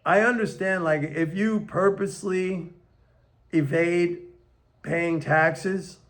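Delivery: ordinary delivery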